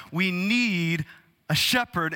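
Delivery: usual speaking voice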